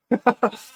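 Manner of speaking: high, loud yipping hyena laugh; hyena cackle. Retold or imitated. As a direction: cackle like a gnoll. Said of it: "and they sound like".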